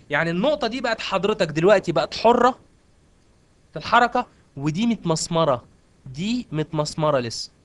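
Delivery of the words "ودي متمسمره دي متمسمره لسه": slow emphasis. Real, not acted. Teacher stressing the fixed point.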